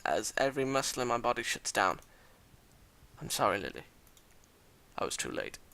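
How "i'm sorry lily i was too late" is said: low, very soft, fading out; faint, defeated last words; dying apology. Acted. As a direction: let the voice sink away as you apologise.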